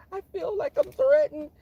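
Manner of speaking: very high, whiny mock-frightened voice; hammed-up tearful victim squeal; mock crying. Coworker crying to managers about feeling threatened, mock tearful. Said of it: "they tend to cry".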